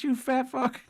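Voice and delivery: very high mocking squeal; squeaky mock Fuentes. Vlogger imitating pundit insulting Trump, high squeal. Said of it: "He said".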